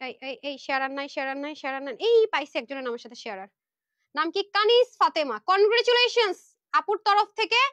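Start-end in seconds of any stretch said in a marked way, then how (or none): 4.6-7.6 loud, high, celebratory call; excited winner announcement. Real, not acted. Host congratulating a viewer on a free dress, excited announcement.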